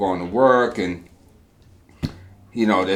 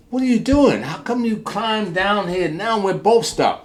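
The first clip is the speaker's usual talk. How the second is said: much higher exasperated voice; dismayed trapped man; 10 semitones higher than usual. Acted. Trapped man scolding helper now stuck too, high exasperated. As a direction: scold him for climbing down too.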